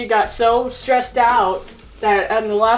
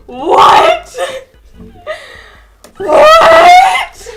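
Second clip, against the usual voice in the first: high, loud, laughing shriek; mock wail; 12 dB louder than usual. Lainey learning she failed, mock wailing shriek. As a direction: shriek it in fake despair. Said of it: "She's just like".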